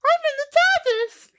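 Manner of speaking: very high sped-up squeaky chipmunk voice. Retold or imitated. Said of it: "I sound like a chipmunk".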